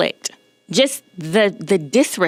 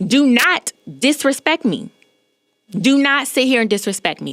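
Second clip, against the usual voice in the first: mock yell, pitched up in protest; retold yell; 6 semitones higher than usual. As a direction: retell a firm scolding yell without full volume. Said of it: "and yelled".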